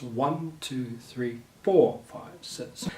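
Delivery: soft count with the accent on "four"; stress on four. Shown as a demonstration. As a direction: count to six, punching "four". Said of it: "pronounce it with more emphasis".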